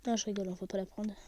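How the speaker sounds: ordinary delivery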